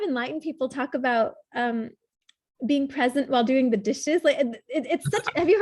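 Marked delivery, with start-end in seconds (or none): none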